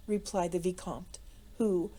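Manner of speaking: plain reading voice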